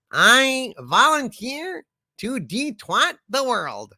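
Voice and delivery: cheerfully volunteer in a squeaky sidekick voice; [name]. Acted. [name] answers the superchat about the twatters in his own voice. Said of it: "Thank you, [name]".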